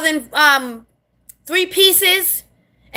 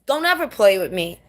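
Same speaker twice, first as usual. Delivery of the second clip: normal talking voice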